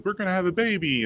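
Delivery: high, excited imitation of relatives; retold excitement. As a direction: imitate thrilled family sharing baby news, pitched high. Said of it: "they were super excited".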